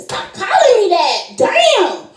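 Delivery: annoyed laughing snap. Retold or imitated. Annoyed at nickname.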